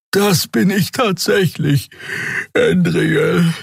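a weary beaked creature, drawn out; tired Draxül. A narrator's reading. Xea to Endriel, tired and slow.

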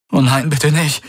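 high-pitched dismay; distraught plea. A narrator's reading. Man at his collapsed friend's side, distraught. Feigned grief at friend's fate.

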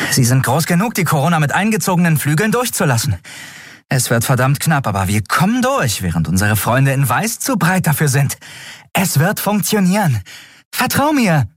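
a pilot focused mid-chase, talking fast; determined young pilot. Acted. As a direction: Endriel explaining her escape route. Endriel insists plan works.

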